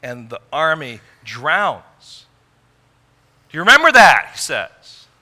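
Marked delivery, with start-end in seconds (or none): 3.5-4.3 loud, high and commanding; God demanding they remember, loud and high; God's booming question. Acted. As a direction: thunder it at the people. Preacher voices God confronting Israel.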